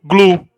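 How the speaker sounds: "glu" called out loud and set apart as the stressed syllable. Shown as a demonstration. Teacher calls out "glu" as the stressed syllable of glúten. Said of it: "penúltima".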